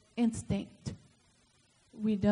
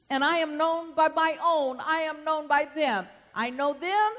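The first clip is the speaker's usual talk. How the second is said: emphatic scripture reading; 2 semitones higher, 7 dB louder than usual. Acted. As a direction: proclaim the verse to the congregation. Preaches scripture aloud.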